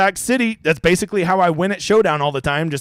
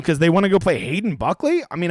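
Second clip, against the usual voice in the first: ordinary delivery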